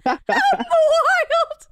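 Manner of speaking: high, slow laughing echo. Real, not acted. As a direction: repeat it while cracking up. Repeats with big laugh.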